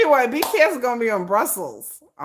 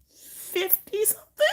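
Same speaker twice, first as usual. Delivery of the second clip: laughing; 13 semitones higher, 4 dB quieter, slower than usual. Real, not acted. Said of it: "I'm like".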